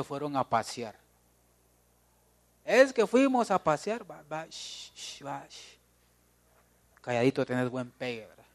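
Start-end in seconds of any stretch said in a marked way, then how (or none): 2.6-4.1 slow, loud boast in a higher pitch; childish boasting, drawn out; bragging child voice. Acted. 4.1-5.8 soft shushing; hushing a child, very soft. Acted.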